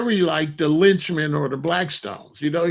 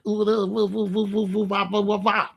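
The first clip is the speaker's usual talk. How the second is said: mocking ooh wah chant; 4 semitones higher than usual. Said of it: "I was like".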